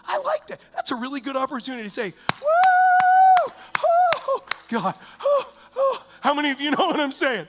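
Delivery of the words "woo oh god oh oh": high whooping praise cry; ecstatic cry. Pretend. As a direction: let out a high joyful whoop. Mock ecstatic worship outburst.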